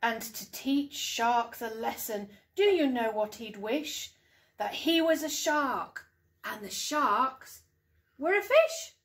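lively storytime voice with big rises on the wishes; bouncy picture-book narration, hushed at the end; reading to children. Acted. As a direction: read it like bedtime storytime for young kids. Mum reads picture book aloud.

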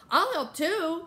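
put-on high, keen child's voice; eager volunteering. A narrator's reading. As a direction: pipe up to join in. Little child eagerly volunteering to help.

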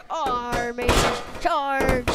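rally the troops; battle cry. Pretend. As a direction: kid playing a toy leader yelling charge. Toy figure calls a charge.

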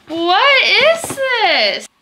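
high, loud and slow, truly puzzled; puzzled surprise. Real, not acted. Opening an unexpected package, loudly baffled. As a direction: drag it out, baffled by the box.

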